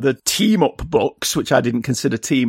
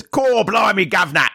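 mock Cockney accent; 5 semitones higher than usual. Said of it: "nobody says".

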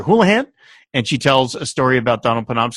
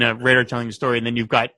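usual speaking voice

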